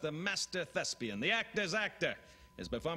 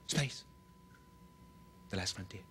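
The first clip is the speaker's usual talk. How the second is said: William Shatner; 6 semitones lower, 7 dB quieter than usual. Retold or imitated. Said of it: "William Shatner".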